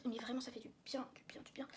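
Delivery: breathless whisper, winded; panting the words out after a climb; out of breath. Real, not acted. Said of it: "j'ai le souffle coupé déjà".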